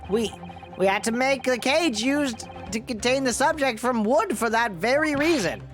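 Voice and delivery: put-on high, creaky old man voice. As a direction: speak like a fussy old professor, pitched high. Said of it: "He's turning into the old man voice".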